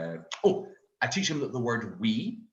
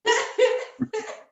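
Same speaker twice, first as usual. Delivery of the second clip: squealing laugh; 6 dB louder than usual. Retold or imitated. His own high laugh.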